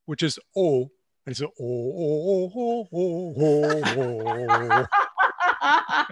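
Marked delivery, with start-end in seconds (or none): 1.6-6.1 "oh" as the French "eau", repeated through laughter; imitated French "eau" with laughter. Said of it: "he said the French word for water".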